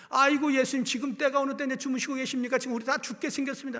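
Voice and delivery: loud, high, rushed alarm; panicked plea. Acted. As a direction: wake him in a frantic hurry. Disciples panicking wake Jesus.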